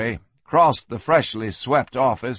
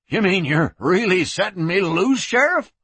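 high voice climbing to a squeak at the end; astonished drunkard; 6 semitones higher than usual. Acted. Prisoner being set loose by sheriff, astonished. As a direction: ask it in rising disbelief.

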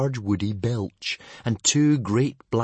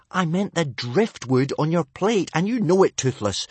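higher, exasperated telling-off; scolding; 7 semitones higher, 3 dB louder than usual. Acted. Boy correcting his dragon's excuse, scolding. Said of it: "scolded Hiccup".

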